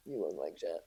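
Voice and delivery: very low, hushed imitation of the analyst; deadpan mock verdict. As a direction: drop the voice right down for the blow. Acting out the analyst's blunt verdict.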